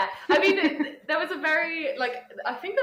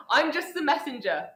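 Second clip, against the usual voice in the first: higher-pitched mock medium's voice; put-on voice, pitched up; Carol impression; 7 semitones higher, 3 dB louder than usual. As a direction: playfully mimic her protesting her innocence. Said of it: "did she do the whole thing where she was like".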